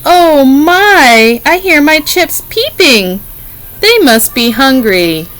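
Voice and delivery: mother bird voice. Acted. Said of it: "this little bird is saying".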